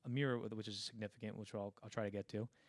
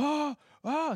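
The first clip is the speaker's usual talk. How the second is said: high, loud mock screams; imitated nightmare yell; 10 dB louder than usual. Man yelling at his typewriter in a nightmare, imitated high yells. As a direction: cry out twice, imitating a sleeper's yells. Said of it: "she hears Jack yelling at his typewriter".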